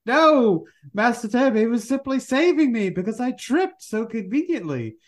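high-pitched, protesting butler impression; mock-anxious servant, pitched well up; Alfred. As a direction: plead like a put-on butler explaining himself. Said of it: "Alfred has to say".

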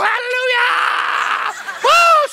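very high, long-held hallelujah and whoop; mom's praise shout. Retold or imitated. Mimicking mom answering the prophecy, ecstatic shout. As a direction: let loose like a mother overcome in church. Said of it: "My mom would go".

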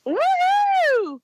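high excited whoop; cheering woohoo. Real, not acted.

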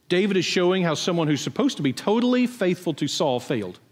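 normal talking voice

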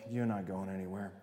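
low, quiet and firm; God, flatly refusing to let him go; stern God voice. Retold or imitated. God stops Jonah at sea, low and quiet. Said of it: "and God's like".

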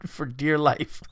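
normal talking voice

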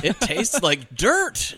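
slow, drawn-out complaint; mock childish disgust. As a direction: stretch it out like a kid refusing a drink. Retells kids refusing grandparents' soda.

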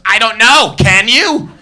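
high, loud weepy wail; hammed-up tearful wail at a KFC cashier; mock sobbing. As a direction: play the hysterical crying customer. Sobbing woman answers cashier.

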